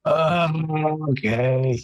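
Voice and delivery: long drawn-out groaned okay; groaning. Acted. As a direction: groan it out like getting up is a chore. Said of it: "Hemo just groans".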